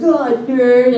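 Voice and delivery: put-on high, loud bratty kid; little kid voice. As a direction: taunt like a nine-year-old. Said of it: "said a bunch of little kid stuff".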